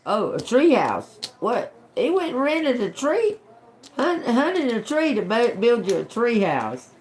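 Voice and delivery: high, incredulous guessing; can't believe what she's hearing; surprised disbelief. Real, not acted. Guessing words, amused disbelief.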